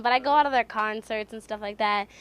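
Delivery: usual speaking voice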